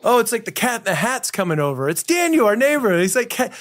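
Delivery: high, playful voice announcing a mischievous neighbor; storybook voice for kids. Retold or imitated. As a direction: tease it like a children's tale. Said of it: "a character".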